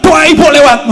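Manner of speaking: loud high call to open the gate; urgent shout. Acted. Announcing prayer passing. (malaikat berseru buka)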